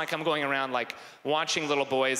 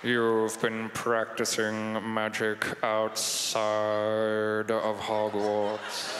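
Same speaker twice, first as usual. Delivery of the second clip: low, very slow, drawn-out drawl; slow, ominous British professor; Snape; 5 semitones lower, much slower than usual. Acted. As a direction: stretch every word out in a low monotone. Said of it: "I gotta find Snape's voice".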